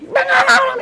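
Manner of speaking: shrieked, very high mock scolding; enraged mom. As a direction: screech like a mother on the warpath. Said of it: "She's like".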